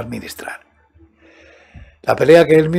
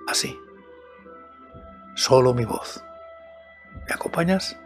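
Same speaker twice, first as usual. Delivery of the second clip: hushed storyteller; 2 semitones lower, 5 dB quieter than usual. Acted. Hushed intro inviting listener.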